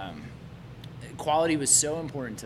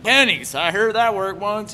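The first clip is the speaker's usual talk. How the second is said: put-on dopey drunk kid; 8 semitones higher, 10 dB louder than usual. His drunk reply to police.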